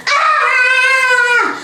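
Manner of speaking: high sudden scream of fright; retold scream. Scream when the bird flies at her face. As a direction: shriek like a bird just hit you.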